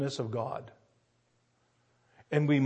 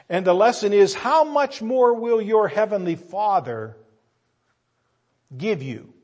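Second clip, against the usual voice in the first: preaching emphasis; 5 semitones higher, 6 dB louder than usual. Acted. Preaching climax.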